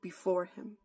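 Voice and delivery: prophetic preaching. Acted. Preacher reads his written word to the church, loud and grave. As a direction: declare each word as a warning from God.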